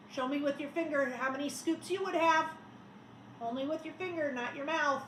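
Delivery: ordinary delivery, nothing marked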